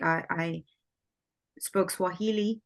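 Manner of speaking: ordinary talk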